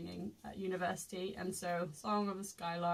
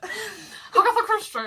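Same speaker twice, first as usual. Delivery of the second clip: plummy posh English 'Agatha Christie', higher and louder; 11 semitones higher, 10 dB louder than usual. Pretend. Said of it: "If you say it like a posh person".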